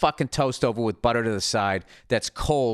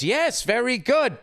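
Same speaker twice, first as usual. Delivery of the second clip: mocking; 10 semitones higher, slower than usual. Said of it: "Making fun of me".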